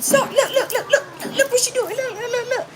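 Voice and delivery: high squealing mock voice, laughing; mimicking the man. Retelling the man pointing her out to police, high laughing voice. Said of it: "So he said".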